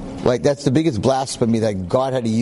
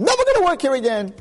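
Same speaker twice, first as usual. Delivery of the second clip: quitting in a huff; 13 semitones higher, faster than usual. Retold or imitated. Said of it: "He said".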